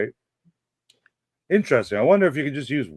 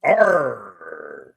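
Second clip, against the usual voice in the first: pirate growl; 5 semitones lower than usual. Pretend. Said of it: "Talk Like a Pirate".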